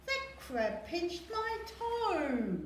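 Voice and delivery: put-on a child wailing about a pinched toe; high, slow complaint. A narrator's reading. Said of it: "cried Bob".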